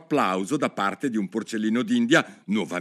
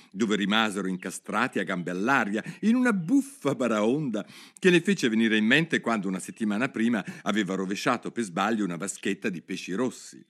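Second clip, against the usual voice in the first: plain reading voice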